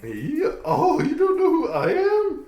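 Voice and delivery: high, proud spirit laughing; pompous ghost voice. Acted. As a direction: boast like a vain old ghost. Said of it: "Everybody knows Jakku the Polyglot".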